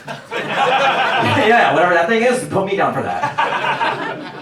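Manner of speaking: high, laughing and drawn out; laughing eager convert. Retold or imitated. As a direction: gush through laughter, slowing down.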